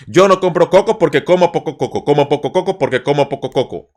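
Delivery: quick, even rattle; fast tongue twister. Shown as a demonstration. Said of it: "Let's try one more time".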